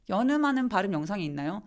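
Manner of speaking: ordinary delivery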